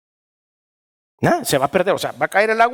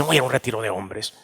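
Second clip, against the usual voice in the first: muttered repeat; 6 semitones lower, 6 dB quieter, faster than usual. Retold or imitated. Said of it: "repeated quieter, faster".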